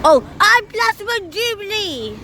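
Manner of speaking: high, thrilled call-out; excited spotting. Real, not acted. Bus spotter seeing Platinum Jubilee, high excited call.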